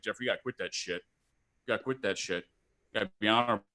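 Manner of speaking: low, soft, fast fatherly scolding; father lecturing his son about honesty in a low voice; gruff dad. Retold or imitated. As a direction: growl it like a stern old father.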